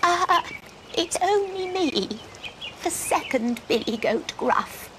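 timid second goat, starting high; soft goat voice. Acted. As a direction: speak up small and scared. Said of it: "spoke in a rather soft voice".

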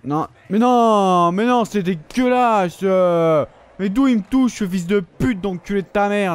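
loud, slow, drawn-out raging shout; outraged gamer meltdown, loud and high; furious yell. Real, not acted. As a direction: scream at the game in real fury. Raging at unfair game death.

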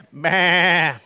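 bleating meh; sheep meh. Shown as a demonstration. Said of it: "you say".

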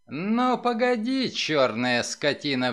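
ordinary delivery

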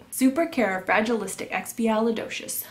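ordinary talk, nothing marked

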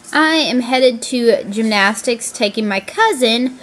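usual speaking voice

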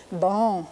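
bon with a nasal vowel and no n closure; French nasal vowel. Shown as a demonstration. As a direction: hold bon out through the nose. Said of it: "it's got that nasal sound to it".

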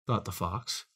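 usual speaking voice

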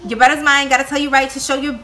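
a breathless rapid-fire attempt; fast lyric reading. Shown as a demonstration. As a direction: rattle it off, third try. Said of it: "One more time".

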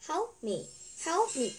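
high-pitched, insistent calling out; shouted plea. Acted. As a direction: call for help, voice jumping up. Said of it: "he shouted more loudly".

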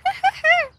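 high, exaggerated sweet laugh; hammed-up model laugh; demo laugh. Shown as a demonstration. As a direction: show her how to laugh. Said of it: "يلا اضحكي ضحكة حلوة".

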